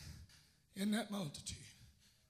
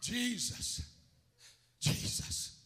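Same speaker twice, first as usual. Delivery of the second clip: a firmer, more voiced call; raised but still restrained plea; louder demonstrated call; 2 semitones higher, 7 dB louder, much slower than usual. Shown as a demonstration. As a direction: call the name out so it carries. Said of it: "You gotta be loud".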